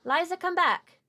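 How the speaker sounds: put-on distant woman calling a girl home. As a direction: call her back across the clearing.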